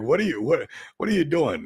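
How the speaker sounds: high, stammered imitation of disbelief; mock bewilderment. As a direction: sputter it like a baffled onlooker. Imagined baffled onlooker, acted.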